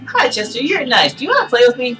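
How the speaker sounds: high little doll voice; sweet, squeaky toy doll; talking doll. Acted. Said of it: "Pull the string".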